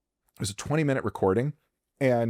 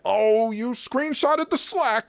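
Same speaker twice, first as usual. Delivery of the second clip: mock scolding; 11 semitones higher, slower than usual. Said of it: "that is far more serious than".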